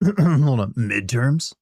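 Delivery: rising questioning soldier voice; Solid Snake. Pretend. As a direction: repeat it back as a terse soldier. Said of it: "congratulate me and Solid Snake's voice".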